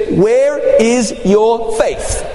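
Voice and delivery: slow, deliberate question; slow rebuke. Retold or imitated. Jesus to frightened disciples, slow deliberate question. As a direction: weigh every word.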